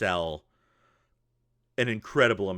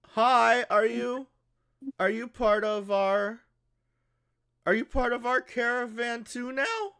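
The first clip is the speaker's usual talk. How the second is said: put-on high-pitched kid calling out; screaming little kid; 5 dB louder than usual. Little kid calls to the giant cat creature, screaming out. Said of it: "as he screams that out".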